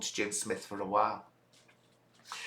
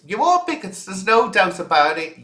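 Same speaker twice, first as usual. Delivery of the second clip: high, louder accusation, ARE hit hard; raised accusing voice; indignant outburst; 6 semitones higher, 5 dB louder than usual. Real, not acted. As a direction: hurl the accusation at them. Accusing viewers in a rant.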